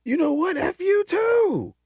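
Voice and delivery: very high, loud, slow yell building to the insult; hammed-up furious far-off shout; building mock shout. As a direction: scream it across the sea, stretching the last words. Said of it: "And then he goes".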